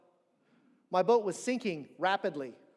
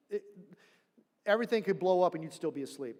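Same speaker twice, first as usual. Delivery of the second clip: ordinary delivery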